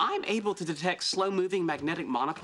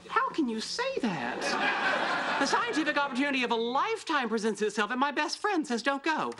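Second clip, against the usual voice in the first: indignant; 6 semitones higher than usual. Acted. Indignant at friend's advice not to go.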